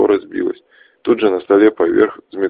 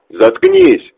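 furious yelled command; angry shout; 8 dB louder than usual. Acted. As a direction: bark it out in rage. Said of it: "гневно закричал Чес".

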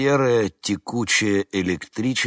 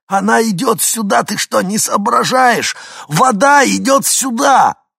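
high, loud, slowing yell; desperate shout; 13 dB louder than usual. A narrator's reading. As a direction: scream the warning in desperation. Hinkin desperate warning.

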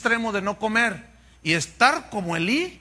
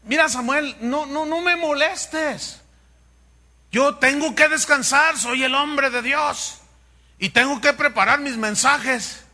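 higher, louder self-important complaining; pompous priest; 7 semitones higher, 4 dB louder than usual. Pretend. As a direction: play the self-important man of God. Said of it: "paráfrasis". (sacerdote presumido, más alto y fuerte)

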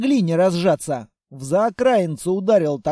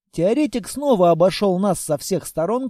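ordinary delivery, nothing marked

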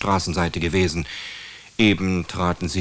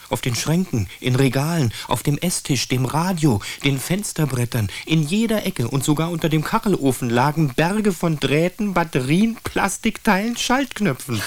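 growing amazement; 9 semitones higher than usual. A narrator's reading. Narrator reveals grandmother's flat, rising astonishment. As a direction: pitch climbing higher item by item. Narrator lists piles of broken remotes.

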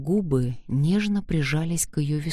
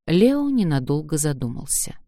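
plain reading voice

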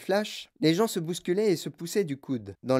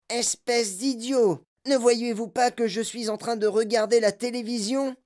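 put-on angry boy; 5 semitones higher than usual. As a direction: snap at the intruders. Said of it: "dit-il d'une voix courroucée".

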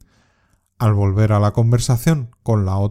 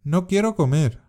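"No quiero comer." said higher, with "quiero" stressed and falling; sulky refusal; 6 semitones higher than usual. Shown as a demonstration. Teacher demonstrating a sulky refusal for learners to repeat. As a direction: complain like a child refusing food. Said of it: "Repite después de mí".

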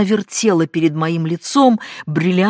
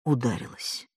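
mumbled; 4 semitones lower, 7 dB quieter than usual. A narrator's reading. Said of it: "пробормотала я".

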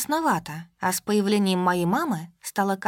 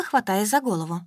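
usual speaking voice

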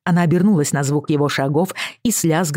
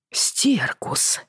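whispered curse; 6 semitones higher, 5 dB quieter than usual. A narrator's reading. Muttered curse on hearing news.

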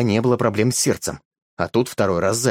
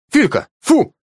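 much higher, louder sharp yell; shouting a command; 13 semitones higher, 5 dB louder, slower than usual. Acted. Grandpa yells at the dog to stop, loud. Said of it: "кричал дед".

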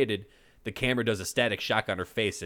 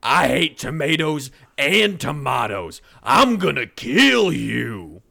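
slow, much louder enraged roar; furious scream; 5 semitones higher, 10 dB louder, slower than usual. Acted. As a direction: scream it shaking with rage. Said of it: "lets out a furious scream".

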